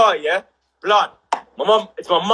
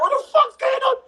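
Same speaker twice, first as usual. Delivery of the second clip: much higher, mock disbelief; shocked disbelief. Watching nan fight from the car, shocked squeal. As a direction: squeal it out in amazement. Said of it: "I was like".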